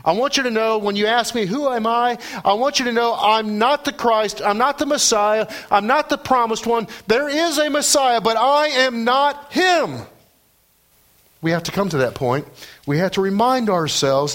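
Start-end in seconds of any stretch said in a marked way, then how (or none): 0.0-10.0 John insisting he is not the Messiah, rising in force; emphatic declaration. Retold or imitated. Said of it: "he says it emphatically".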